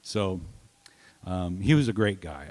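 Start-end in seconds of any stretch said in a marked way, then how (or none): none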